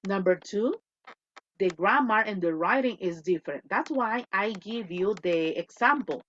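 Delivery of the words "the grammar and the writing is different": usual speaking voice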